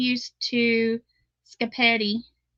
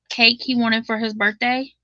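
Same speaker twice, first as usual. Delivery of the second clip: ordinary talk, nothing marked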